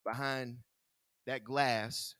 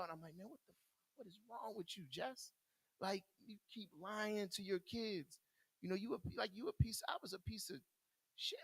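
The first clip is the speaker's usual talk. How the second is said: higher and much softer, a choked inner voice; crying to himself; 7 semitones higher, 7 dB quieter than usual. Retold or imitated. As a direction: scold yourself softly while holding back tears. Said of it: "I'm crying in this cell".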